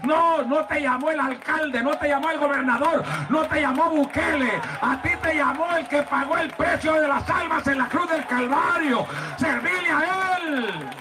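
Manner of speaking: fiery preaching. Acted. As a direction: thunder it at the congregation like a revival preacher. Preaches at full force.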